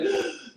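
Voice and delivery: high sharp mock gasp; shocked gasp. Reacting to mom's purchase.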